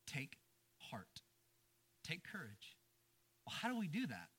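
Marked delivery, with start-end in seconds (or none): 0.1-2.8 two words set apart, falling much softer; hushed emphasis. Real, not acted. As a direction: let it sink in quietly. Climax of the sermon's theme.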